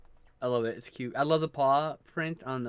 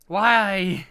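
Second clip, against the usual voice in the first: exasperated whine; 7 semitones higher, 9 dB louder than usual. Retold or imitated. Exasperated about nails.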